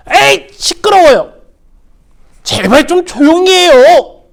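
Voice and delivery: high, loud irritated scolding; annoyed. Acted. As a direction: hush him up with irritation. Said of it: "짜증을 내기 시작했어요".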